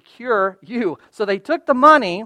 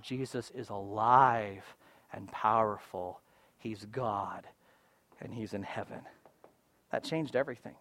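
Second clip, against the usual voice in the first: hushed reverence; 9 semitones lower, 15 dB quieter than usual. Acted. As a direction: let it sink in quietly. Preacher's hushed reverent close.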